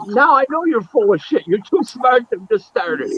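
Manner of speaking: high-pitched mock disbelief; quoted scoffing, high and animated; incredulous retort. Quoting Dundee calling his bluff.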